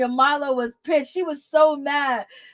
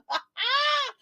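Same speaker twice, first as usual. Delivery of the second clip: a high shriek of laughter; real shriek of laughter; 13 semitones higher, 4 dB quieter than usual. Real, not acted. Laughing at own slip.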